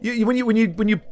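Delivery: ordinary talk, nothing marked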